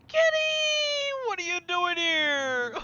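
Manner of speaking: very high, loud, slow startled cry. Real, not acted. Streamer startled by the door opening, loud gasp. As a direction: gasp and call out in alarm.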